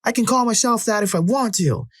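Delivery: very high, defiant mock yell; acted stubborn comeback, squeezed up high. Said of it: "And I was yelling".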